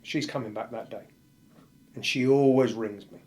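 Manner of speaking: ordinary talk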